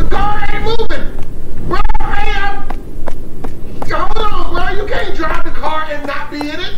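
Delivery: loud, high yelling, slowing at the start; shouting. Acted. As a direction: holler desperately after the car. Yelling at car driving off.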